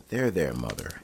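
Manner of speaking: ordinary delivery, nothing marked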